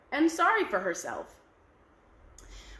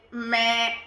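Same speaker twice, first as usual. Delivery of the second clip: goat bleat; 5 dB louder than usual. Acted.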